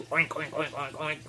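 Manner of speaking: quick run of imitated oinks; imitation pig noises; pig oinking. Shown as a demonstration. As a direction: oink like a pig in a rapid string. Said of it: "What do you think it sounded like".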